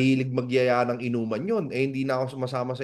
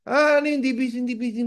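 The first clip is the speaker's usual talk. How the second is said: higher, puzzled repeat; baffled question; 8 semitones higher, faster than usual. Retold or imitated. Friend puzzled by the acronym, asking high and baffled. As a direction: ask what on earth that is.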